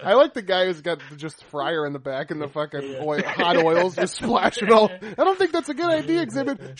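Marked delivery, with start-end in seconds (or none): 4.9-6.8 worried car owner. Pretend. Car owner voiced worrying.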